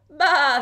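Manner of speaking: high, very loud bleat; sheep baa. A narrator's reading. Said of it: "said the sheep".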